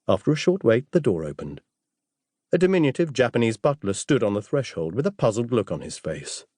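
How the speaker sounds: usual speaking voice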